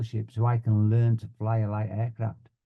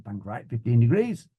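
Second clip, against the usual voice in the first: echoed order with a rising end; student's repeat. Retold or imitated. Student pilot answering the flying instructor, obedient repeat. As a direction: repeat it back as if checking.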